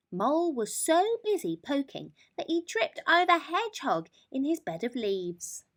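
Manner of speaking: plain reading voice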